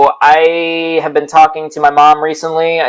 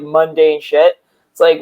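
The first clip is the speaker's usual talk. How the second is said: ordinary talk, nothing marked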